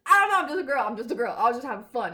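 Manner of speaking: loud laughing through the words; laughing loudly. Real, not acted. Admitting she flirted with ghosts, loud laughing. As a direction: laugh it out, giddy.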